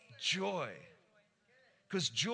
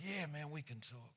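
soft reply; 11 dB quieter than usual. Retold or imitated. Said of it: "Luke goes".

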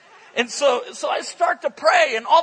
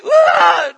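a short, loud, high scream of shock; one sudden scream as she staggers back; witch's scream; 5 semitones higher, 11 dB louder than usual. Retold or imitated. Witch cries out, stumbles back.

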